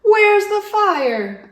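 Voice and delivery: very high, loud, slow; sarcastic exclamation. Acted. Yak mocks everyone's hurry, loud and high. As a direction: throw the phrase out mockingly.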